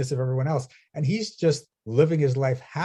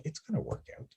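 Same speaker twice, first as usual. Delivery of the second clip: quiet reassurance; 3 semitones higher, 12 dB quieter than usual. Retold or imitated. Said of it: "that space of".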